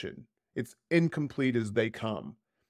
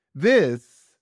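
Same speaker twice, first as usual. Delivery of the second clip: THIS said much louder, drawn out and falling; emphatic capitals; 3 semitones higher, 9 dB louder than usual. Retold or imitated. Reading out an influencer's capitalized word, heavy stress on THIS. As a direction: hit THIS hard, as if written in capitals. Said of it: "and they capitalized".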